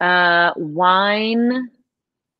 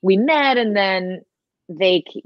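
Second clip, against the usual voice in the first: ordinary talk, nothing marked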